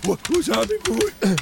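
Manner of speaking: Manny character voice. Acted. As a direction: play the odd cheerful Manny.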